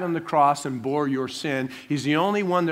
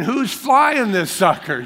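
slow, higher, louder mock alarm; alarmed thought; 7 semitones higher, 7 dB louder, slower than usual. Passenger sees pilot walk by, slow loud mock alarm. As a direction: draw it out in comic panic. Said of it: "I'm thinking".

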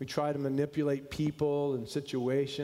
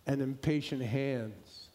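poem recitation; 3 semitones lower than usual. Acted. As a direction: let each line fall gently, unhurried. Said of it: "It goes like this".

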